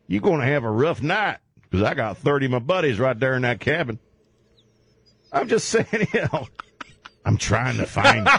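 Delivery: ordinary talk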